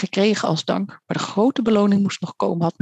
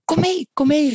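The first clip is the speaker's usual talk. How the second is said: high, urging bird call; 8 semitones higher than usual. A narrator's reading. Gull urging the krullevaar to follow, calling high. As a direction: call out to coax him along. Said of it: "riep Karel".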